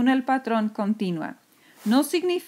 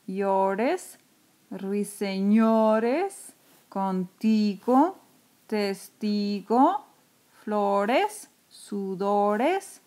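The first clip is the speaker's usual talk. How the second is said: slow, drawn-out reading; much slower than usual. Shown as a demonstration.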